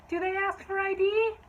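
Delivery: high-pitched, loud question; hammed-up shrieky voice; whiny customer. Acts the customer asking suspiciously.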